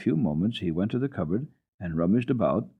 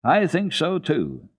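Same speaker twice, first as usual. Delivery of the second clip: put-on higher, slow eager child voice; hearty agreement; 9 semitones higher, 3 dB louder, slower than usual. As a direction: agree warmly like a little girl. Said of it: "Heidi heartily agreed".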